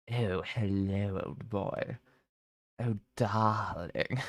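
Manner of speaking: slow, drawn-out posh drawl; lightly hammed-up posh greeting. Joking to chat, stuck in the posh voice. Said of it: "I can't get out of the voice".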